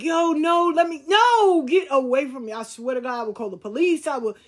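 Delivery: loud, high, defensive protest; mock threatening to call police, pitched high; retold heated denial.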